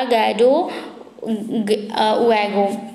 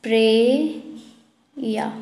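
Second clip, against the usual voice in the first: stretched out slowly; teacher drilling the answer for students, slowly; slow drilled repeat; 3 semitones lower, much slower than usual. Shown as a demonstration. Draw it out for them to echo. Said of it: "क्या है?".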